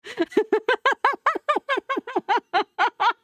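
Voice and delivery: high burst of laughter. Real, not acted.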